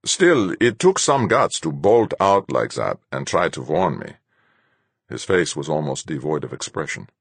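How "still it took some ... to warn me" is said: louder, appraising captain, high on the first word; grudging credit in the captain's voice; captain's character voice. A narrator's reading.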